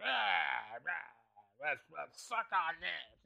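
soft, slow mock lewd voice; hammed-up sleazy offer, quietly; mock lewd creep. Joke of flashing someone, mock sleazy quiet voice. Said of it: "It was just like".

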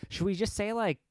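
high, airy mock of AOC. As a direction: play her as clueless and high-pitched. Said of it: "she's just like".

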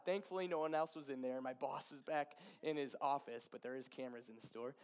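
ordinary talk, nothing marked

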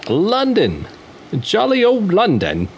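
put-on a first playful stab at a British accent; mock British accent. Podcast host introducing a London band in a mock British accent. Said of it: "the impersonation I did, the little accent".

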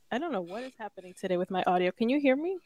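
faint bad mic audio. Real, not acted. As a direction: sounds far from the mic. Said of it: "you sound a little faint".

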